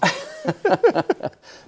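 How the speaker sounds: loud high amused laugh; laughing at himself. Real, not acted. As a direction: laugh at being teased. Laughs at "classic for you.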